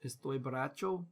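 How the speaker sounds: clumsy tourist Spanish, lightly put on; bad Spanish accent. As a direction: fake a Spanish accent badly, as a joke. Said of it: "Sorry, my Spanish is terrible".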